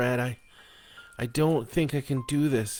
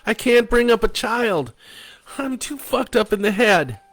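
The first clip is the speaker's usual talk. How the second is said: much higher and louder, near a wail; hysterical; 8 dB louder than usual. Acted. Wife refusing motherhood to her husband, hysterical wail. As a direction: break into a hysterical cry. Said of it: "She was hysterical".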